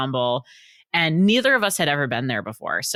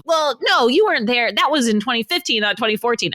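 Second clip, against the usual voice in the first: higher, nitpicking husband voice; husband's live commentary; 8 semitones higher, 3 dB louder than usual. Retold or imitated. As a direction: nitpick the dates like a know-it-all husband. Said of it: "live commentary".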